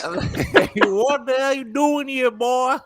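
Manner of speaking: redoing the country homeowner, cracking up; country accent through laughter. Retold or imitated. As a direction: laugh while doing the country voice. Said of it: "country ass accent".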